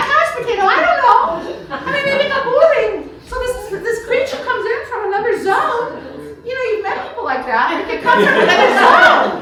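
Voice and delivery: giggling her way through the idea; laughing. Real, not acted. Author laughing as she pictures a bored Adam.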